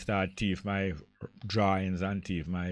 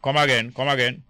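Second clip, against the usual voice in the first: asking loudly; 3 semitones higher, 7 dB louder than usual. Real, not acted. Didn't catch caller's words.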